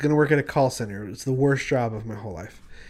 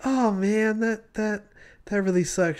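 higher, mildly sympathetic mock reply; lightly voiced younger self; 8 semitones higher than usual. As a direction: play his awkward teenage self, lightly. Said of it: "and I'm like".

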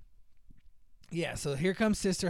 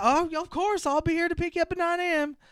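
much higher, syrupy reassurance; fake sweet promise; 13 semitones higher than usual. Mother promising to be there, high syrupy. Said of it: "she's like".

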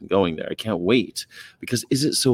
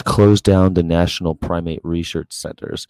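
louder, reading the shirt's protest slogan out; 8 dB louder than usual. Real, not acted. Streamer reads her T-shirt slogan to viewers, loud and declaiming. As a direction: call it out like a protest chant.

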